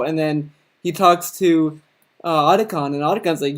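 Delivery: ordinary talk, nothing marked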